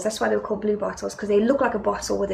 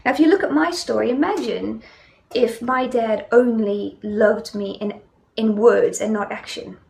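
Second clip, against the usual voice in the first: usual speaking voice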